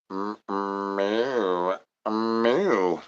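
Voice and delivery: soft, drawn-out mock meow; imitated cat meow. Streamer playing cat after his catchphrase, soft mock meow. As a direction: meow quietly with a lingering hum on the m.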